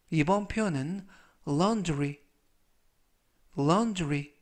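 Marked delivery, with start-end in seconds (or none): 1.5-2.2 laundry said clearly, LAWN-dree; model pronunciation. Shown as a demonstration. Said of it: "laundry라고 발음하시면 되겠고요". 3.6-4.3 laundry repeated, clear LAN-dree; model pronunciation. Shown as a demonstration. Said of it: "laundry라고 발음하시면 되겠고요".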